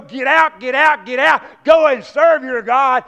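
loud commanding get out, slow at the end; Pharaoh's order. Retold or imitated. Pharaoh ordering the people out, loud command. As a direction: bark it like an angry king.

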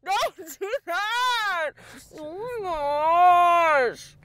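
high, loud shriek of stop, slowing into a long cry; panicked shout. Real, not acted. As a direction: scream at a friend who points out the spider. Friend points out the spider.

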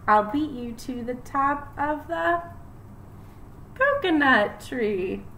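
lively rhythmic picture-book reading; reading to children. Acted. Teacher reading a rhyming book to her class, lively storytime voice. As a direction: read it bouncy for little kids.

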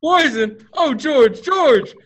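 high, loud cry climbing on the last name; the druggist cries out to the boy who saved him; horrified shout. Acted. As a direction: shout it, shaken and overcome. Gower discovers the poison mistake.

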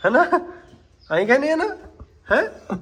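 very high, loud teasing questions; squeaky teasing. Real, not acted. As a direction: tease the horse in a squeaky voice. Teasing horse, high-pitched.

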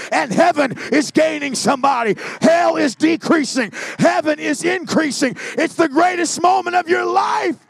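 climbing from firm preaching to a roaring shout; preaching at full force. Acted. Preacher testifying to the congregation, building to a shouted climax.

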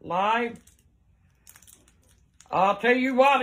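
normal talking voice

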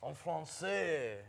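high, drawn-out mock French; French accent. Contestant trying a French accent in a party game, mock French. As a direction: put on a hammed-up French accent. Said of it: "I got French".

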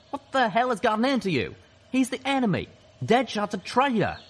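very high, loud accusation; outraged yelling. A narrator's reading. Gutshot accuses his comrades of siding with a traitor, outraged yelling. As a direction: yell in furious disbelief at betrayal.

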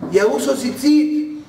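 high, drawn-out excited boast; excited announcement. Retold or imitated. Taxi driver shows the rabbi his tzitzit, excited.

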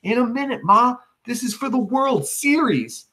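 put-on high boyish call back to Ma; boy calling back to his mother, voice high. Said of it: "Eugene calls out".

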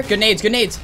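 loud, high hyped praise; excited praise. Real, not acted. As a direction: cheer it out. Praising teammates mid-fight.

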